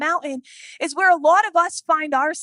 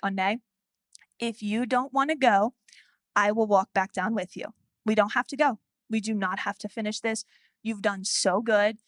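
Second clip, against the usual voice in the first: softer, lower, steady reassuring dad; calm reassurance; 6 semitones lower, 5 dB quieter than usual. Retold or imitated. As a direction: talk her down gently. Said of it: "he's, like, level setting".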